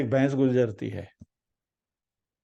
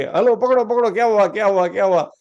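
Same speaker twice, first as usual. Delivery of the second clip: high, louder, urgent villagers' cries; acted hubbub of panicked bystanders; alarmed crowd shouting; 5 semitones higher, 5 dB louder than usual. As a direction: call out like onlookers running to help. Villagers rush after runaway buffalo.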